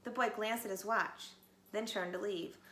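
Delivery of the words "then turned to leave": usual speaking voice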